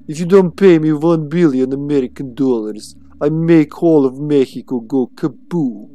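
hammed-up Russian villain accent; mock Russian accent. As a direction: threaten like a cartoon Russian villain. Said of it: "I just love this accent now".

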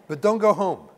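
loud emphatic warning. Real, not acted. Rabbi warning fathers not to walk in frowning, loud emphatic command. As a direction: bark it like a final order, full force.

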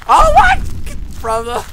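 sudden loud, very high shriek; startled scream. Real, not acted. Player startled by Slender Man, screaming. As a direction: shriek in fright, then falter.

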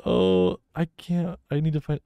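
ordinary talk, nothing marked

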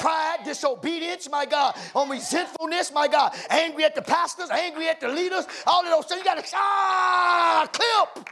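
high preaching list building to a drawn-out shout; building preaching climax. Real, not acted. Preacher listing sins to be clipped, building preaching climax. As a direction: climb phrase by phrase into a long cry.

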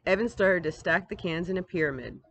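plain reading voice

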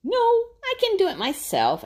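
put-on high, loud stubborn kid refusal; high, stubborn Adam voice; stubborn grunt. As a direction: insist like a proud little raccoon. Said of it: "Adam grunted".

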